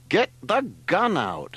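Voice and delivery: high, slow, insistent order; angry. A narrator's reading. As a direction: hammer out each word angrily. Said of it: "said Leslie angrily".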